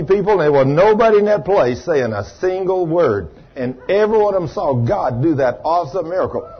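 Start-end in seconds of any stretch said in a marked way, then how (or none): none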